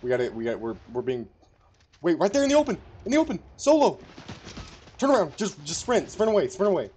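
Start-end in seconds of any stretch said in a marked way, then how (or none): 2.0-6.9 loud, very high, urgent commands; frantic callout shouts. Real, not acted. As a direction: scream orders at a teammate under fire.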